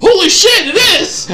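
high, loud burst of joyous disbelief; excited disbelief. Retold or imitated. Reading the tickets from his friend, shouting in disbelief. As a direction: yelp it like you just saw the tickets. Retold discovery of the game tickets.

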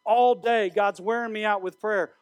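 usual speaking voice

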